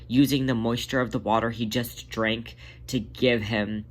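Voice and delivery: ordinary delivery, nothing marked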